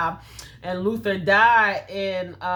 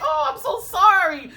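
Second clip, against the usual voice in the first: retold soothing; 10 semitones higher, 4 dB louder than usual. Comforting her crying baby.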